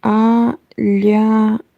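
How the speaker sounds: "Alyanach" drawn out slowly, each syllable separate. Shown as a demonstration. Streamer sounding out a viewer's name, syllable by syllable. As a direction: sound out "Alyanach" in three slow pieces. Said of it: "Can you say".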